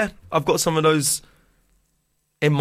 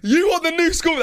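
much higher, put-on teasing voice; mock accusing voice; 13 semitones higher than usual. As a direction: jab at him in a silly high voice. Teasing friend, then "I'm joking.

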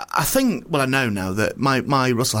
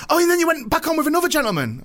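excited clerk. Retold or imitated. Clerk recognising the comedian.

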